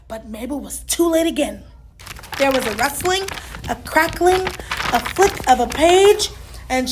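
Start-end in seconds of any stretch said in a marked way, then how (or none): none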